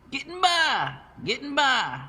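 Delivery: high, gruff bark of a big warehouse man; Bert barking. A narrator's reading. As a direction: bark it out like a jolly fat forklift driver. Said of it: "barked Bert".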